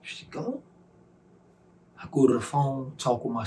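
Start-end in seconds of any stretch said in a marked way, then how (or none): 0.0-0.6 high, hushed question; barely daring to ask it; stunned disbelief. Retold or imitated. Asking God about his mother, a high hushed disbelieving question.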